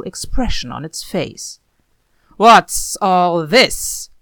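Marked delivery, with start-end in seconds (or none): 2.4-4.1 loud, slow, startled shout; a drawn-out bellow of alarm. A narrator's reading. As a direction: call out across the noisy house. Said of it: "Hawk shouted".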